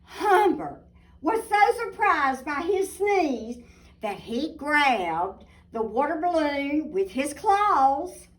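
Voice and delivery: put-on lively storybook narration for kids; reading to children. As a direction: tell it like a bedtime picture book. Reading picture book aloud.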